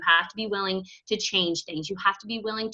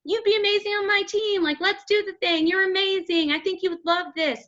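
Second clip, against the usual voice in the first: much higher, bubbly pitch; mock cheerful recruiter voice; upbeat invite; 9 semitones higher than usual. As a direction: sell it with sunny enthusiasm. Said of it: "We're telling people".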